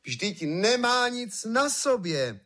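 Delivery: high, slow, drawn-out cry; crowd calling out. A narrator's reading. All the people finally calling out the truth, high cry. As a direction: call it out high, stretching each word. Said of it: "volal nakonec všechen lid".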